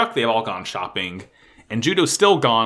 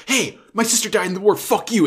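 angry outburst; 9 semitones higher than usual. Retold or imitated. Judo outburst retold.

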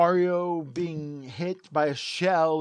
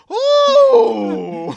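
overjoyed gasp; 13 dB louder than usual. Shown as a demonstration. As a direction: squeal of delight. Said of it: "you need to be like".

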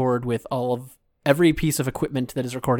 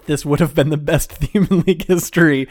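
laughing; 3 semitones higher, 8 dB louder than usual. Real, not acted. Laughing at friend's joke theme.

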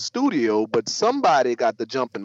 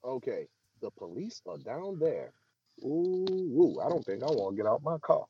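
muffled, much softer pillow sound; talking through a pillow; 2 semitones lower, 7 dB quieter than usual. Real, not acted. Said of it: "are you now talking through a pillow".

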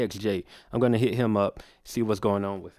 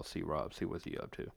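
whispered; 4 semitones lower, 15 dB quieter than usual. Real, not acted. Muttering while dialing.

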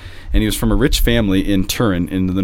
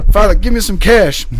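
high, loud hollered demand; yelling out; 12 semitones higher, 7 dB louder than usual. Retold or imitated. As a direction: imitate a street busker shouting at a priest. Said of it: "this guy yells out to me".

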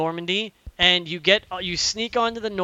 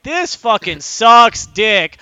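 slow, loud, raised-pitch disgust; exasperated outburst; 4 semitones higher, 10 dB louder, much slower than usual. Retold or imitated. Player retells reacting alone to the bad plot, exasperated. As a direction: drag out each word, loudly fed up.

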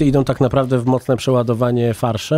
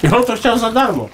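cheeky; 10 semitones higher than usual. Pretend. Joking cheeky request.

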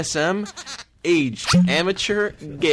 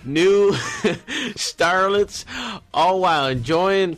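Southern ad pitch with giggles; Southern accent; 4 semitones higher than usual. Acted. Performer pitching starlets to listeners, giggly Southern accent. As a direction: sell it in a lilting Southern twang. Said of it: "If you can read this with Southern accent".

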